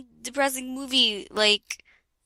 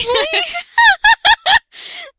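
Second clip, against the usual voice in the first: high shrieking laughter; 10 dB louder than usual. Real, not acted. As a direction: burst into squealing laughter. Laughing at own reading.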